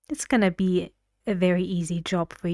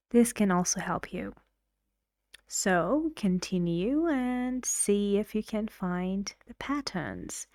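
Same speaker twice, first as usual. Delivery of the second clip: ordinary delivery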